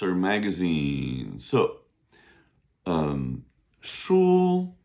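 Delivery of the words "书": 书 held high and level; first tone. Shown as a demonstration. Teacher modelling the word for books, high level first tone. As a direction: say 书 on a long, high, level pitch. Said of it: "S H U first tone".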